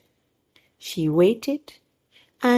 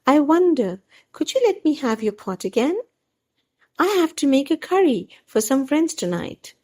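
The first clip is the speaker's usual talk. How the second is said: higher, sugary voice of a polite neighbour; sweet neighbour voice; 7 semitones higher than usual. A narrator's reading. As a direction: charm the neighbour into lending again. Mrs Sheikh's voice.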